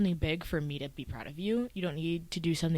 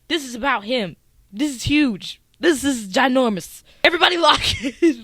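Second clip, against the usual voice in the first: excited; 6 semitones higher, 9 dB louder than usual. Retold or imitated.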